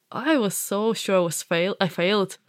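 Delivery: usual speaking voice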